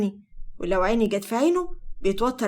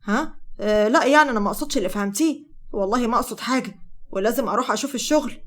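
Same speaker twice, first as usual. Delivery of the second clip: usual speaking voice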